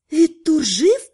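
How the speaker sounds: a sharp gasp of disbelief; gasping surprise. Acted. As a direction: breathe it out in shock. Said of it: "ахнула Наташа".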